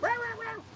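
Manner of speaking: high-pitched mock dog barks; imitated barking. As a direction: bark like an over-excited dog. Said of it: "barking up a storm".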